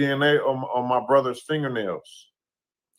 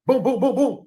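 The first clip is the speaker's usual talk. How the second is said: quick high booms, a touch louder, mouth gunfire; imitated gunshots; 10 semitones higher, 3 dB louder than usual. Picturing the shots fired, mimicking the gunfire. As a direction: fire off each boom like a pistol shot.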